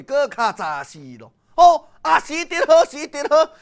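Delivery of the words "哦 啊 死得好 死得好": loud, high cry of triumph; gleeful shout. A narrator's reading. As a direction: shout it out, gloating over the news. Father exults at enemy's death.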